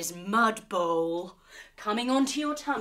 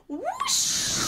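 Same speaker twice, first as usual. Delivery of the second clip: high swooping whoosh rising up; whoosh sound. Pretend. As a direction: sweep the voice up like a zooming board. Hoverboard zooming sound.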